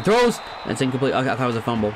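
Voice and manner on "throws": sudden loud call of the throw. Real, not acted. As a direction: blurt the word out loud and high. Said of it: "I said throws so, like, loudly".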